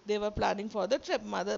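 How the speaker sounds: ordinary talk, nothing marked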